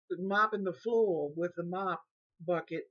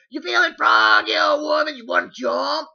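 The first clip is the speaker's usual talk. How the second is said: loud, high, menacing challenge; taunting shout; 8 semitones higher, 14 dB louder than usual. Retold or imitated. Caregiver shouting a fight challenge at old woman, loud and menacing. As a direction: yell the threat in her face. Caregiver repeating threat louder.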